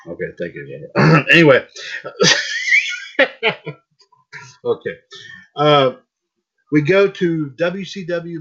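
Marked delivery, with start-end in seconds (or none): 1.7-3.7 sudden high-pitched burst of laughter; loud high laugh. Real, not acted. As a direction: let out a big high laugh. Laughter after throat clearing.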